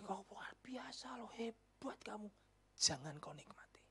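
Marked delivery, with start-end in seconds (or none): 0.0-2.3 whispered flattery. Pretend. Flatterer praising you.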